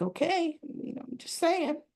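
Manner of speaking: high, soft placating voice; backing off. Retold or imitated. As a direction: imitate a mother gently retreating from an argument. Mother backs off after rebuke.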